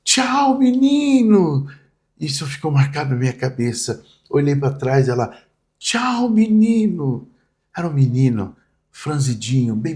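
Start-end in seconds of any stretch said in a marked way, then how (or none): 0.0-1.7 high, drawn-out farewell call; calling out goodbye. Retold or imitated. Woman calls goodbye to the boy crossing the avenue, high drawn-out call. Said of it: "ela grita assim". 5.8-7.3 high, drawn-out farewell call; calling out goodbye. Retold or imitated. Woman calls goodbye to the boy crossing the avenue, high drawn-out call. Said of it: "ela grita assim".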